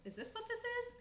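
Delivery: high squirrel voice; high baffled squirrel; local squirrel. Acted. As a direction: voice it high and puzzled.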